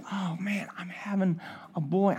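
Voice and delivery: whispered in disbelief, unvoiced. Real, not acted. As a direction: breathe it out in awe.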